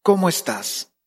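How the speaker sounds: tender talk with God; intimate prayer. Shown as a demonstration. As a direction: lean in and tell your dad a secret.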